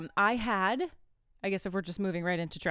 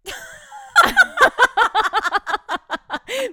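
long high shrieking laugh; high squealing laugh; 14 dB louder than usual. Real, not acted. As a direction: burst out laughing at the phone. Phone recorded everything.

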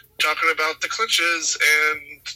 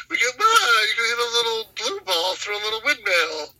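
higher, speaking through laughter; laughing while talking; 8 semitones higher than usual. Real, not acted. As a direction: tease while still laughing.

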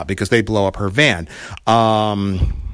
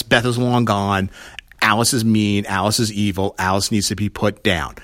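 ordinary talk

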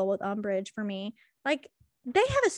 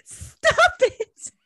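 laughing protest; 12 semitones higher, 6 dB louder than usual. Real, not acted. Laughing at friend's joke.

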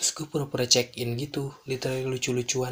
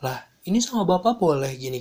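raised, louder mock protest; retold pesky guest; 5 semitones higher, 5 dB louder than usual. Guest to staff after boss allows access, pesky protest. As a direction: imitate a guest objecting. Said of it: "ini tamunya juga rese".

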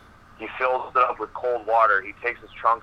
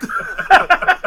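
burst of laughter; 8 dB louder than usual. Real, not acted. Teller cracks up at memory.

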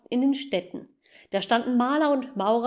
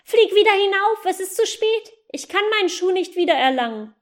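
put-on urgent boy; 9 semitones higher, 6 dB louder than usual. Boy urging goose upward.

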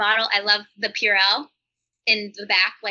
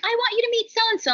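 gushing parent; 12 semitones higher than usual. Retold or imitated. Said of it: "when parents are like".

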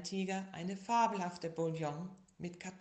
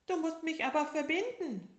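high, loud whiny tiger voice; sick little tiger; 11 semitones higher, 8 dB louder than usual. Acted. Little tiger tells bear how sick he is, high and whiny. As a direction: wail it like a sick little cub. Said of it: "rief der kleine Tiger".

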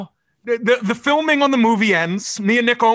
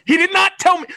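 indignant shout; 8 semitones higher than usual. Real, not acted. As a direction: shout it out, outraged at being left behind. Indignant protest about seats.

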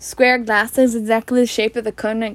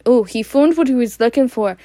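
ordinary delivery, nothing marked

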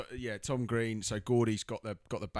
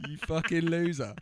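high, loud put-on voice sneering "loser"; mock insult; 8 semitones higher, 6 dB louder than usual. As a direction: jeer at him in a silly high voice. Mocking the character with a put-on voice.

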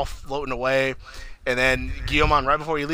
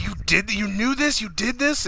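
high-pitched retold accusation; acted-out indignant blame; accusing; 8 semitones higher than usual. Kids accuse the father.

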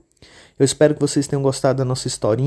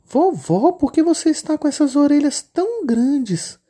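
put-on much higher, childlike little girl voice; 13 semitones higher than usual. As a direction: play the innocent little girl. Menina desconfiada pergunta. (menininha de voz fininha e aguda)

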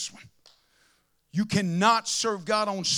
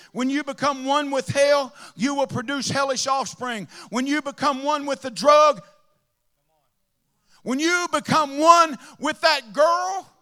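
loud, higher preaching building up; 3 semitones higher, 5 dB louder than usual. Acted. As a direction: build the fire line by line. Preaching at full force.

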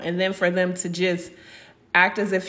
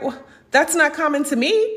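indignant retort; 7 semitones higher than usual. Retold or imitated. Retort to people dismissing her miscarriage.

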